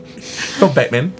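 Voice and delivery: slow Cockney film star impression; Michael Caine. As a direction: draw it out in a London accent. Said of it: "do his Michael Caine impression".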